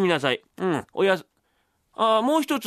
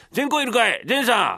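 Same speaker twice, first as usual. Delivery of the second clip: raised, higher calling voice; 4 semitones higher than usual. Acted. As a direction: call out to someone at their door. Calling out for the book lender.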